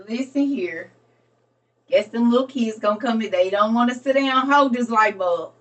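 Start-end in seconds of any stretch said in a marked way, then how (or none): none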